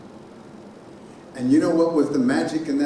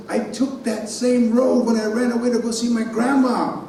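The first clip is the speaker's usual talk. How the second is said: high, raised voice reliving a loss; retold heartbreak, swelling higher; anguished grief; 8 semitones higher than usual. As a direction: plead it out in grief, pitched well up. Reliving realizing his grandmother is dead.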